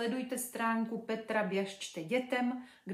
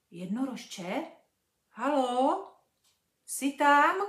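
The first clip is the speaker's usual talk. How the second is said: cautious calling; 4 semitones higher, 8 dB louder, slower than usual. Acted. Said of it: "Zeptala se opatrně".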